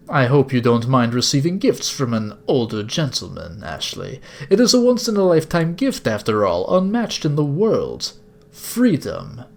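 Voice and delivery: badly accented sinister gentleman; villainous older captor. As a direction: savour it like a courteous kidnapper. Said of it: "sorry if this is a bad accent".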